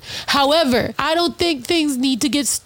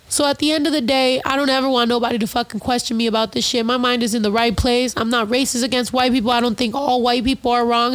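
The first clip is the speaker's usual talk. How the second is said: ordinary talk, nothing marked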